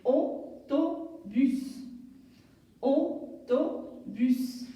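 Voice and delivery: au-to-bus drawn out very slowly, each syllable apart. Shown as a demonstration. Teacher models autobus slowly for the class. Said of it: "A U fait le son O".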